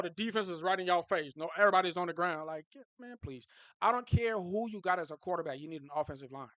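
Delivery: ordinary talk, nothing marked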